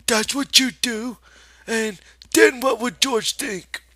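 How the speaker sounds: high, loud mocking rabbit voice; scornful rabbit. The rabbit insults Lenny, loud high scorn. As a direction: berate him with contempt.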